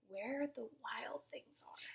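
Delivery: breathy title read, slow and soft; hushed storytime whisper. Acted. Teacher reading to a child, hushed whisper.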